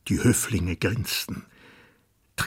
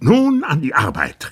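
loud, higher call to work; 9 semitones higher, 9 dB louder than usual. Acted. Fat prime minister rouses the court, loud call. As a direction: boom it across the table. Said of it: "rief der Premierminister".

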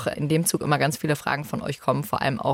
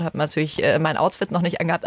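ordinary delivery

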